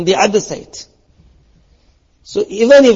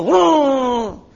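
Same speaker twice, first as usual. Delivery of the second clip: high, revving vroom; a mock car engine roar; 8 semitones higher than usual. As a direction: rev it like a car tearing off. Imitating an angry man's car.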